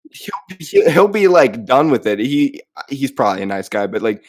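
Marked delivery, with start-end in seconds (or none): none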